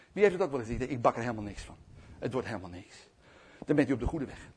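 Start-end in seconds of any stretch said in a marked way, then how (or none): 0.9-3.0 low, soft and discouraged; acted dejection; defeated resignation. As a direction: sink into giving up. Quotes people who despair.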